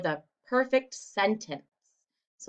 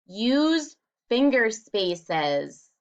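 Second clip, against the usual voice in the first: slow chart reading; much slower than usual. Shown as a demonstration. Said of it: "Number two".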